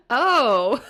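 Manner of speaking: sudden loud surprised oh; caught-off-guard exclamation; startled oh. Retold or imitated. Told horse bites toes.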